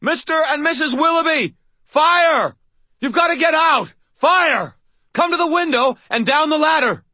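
high, loud, choking shout of warning; strangled shout. Acted. Harry shouts into the burning window, strangled and loud. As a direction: yell through smoke, throat strangled. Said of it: "my voice strangled as I tried to shout".

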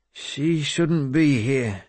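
slow, high, labored speech; struggling to speak. A narrator's reading. Wounded lord objects to the girl's presence, struggling to speak. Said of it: "or tried to say".

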